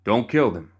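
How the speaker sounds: calling out. Acted. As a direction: call out to Crockett in the brush. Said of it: "called out".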